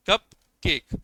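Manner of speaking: कप and केक each given in full, slightly apart; stress on both. Shown as a demonstration. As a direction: sound out कप, then केक, neither dropped. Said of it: "जो आपको लगा कि हाँ वो रह गया".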